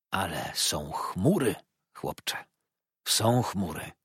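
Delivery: slow, mildly troubled confiding; moved. A narrator's reading. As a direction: dwell slowly on it, a touch moved. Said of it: "Zdawał się wzruszony".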